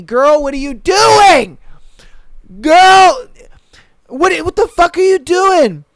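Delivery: high, loud, drawn-out mock yell; imitated yelling ex. Playing the ex yelling at the begging girl, hammed-up slow shout. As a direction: bellow it like he's scolding her across the club. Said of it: "he kept yelling at me".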